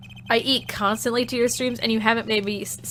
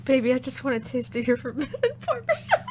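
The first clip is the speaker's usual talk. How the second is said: mock seductive; 5 dB quieter than usual. Mock seductive line to chat.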